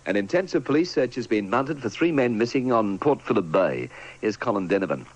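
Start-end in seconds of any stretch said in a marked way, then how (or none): none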